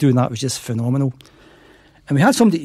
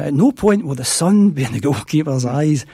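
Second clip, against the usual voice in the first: slow quoted line. Retold or imitated. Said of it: "sounded like a Chinese proverb to me".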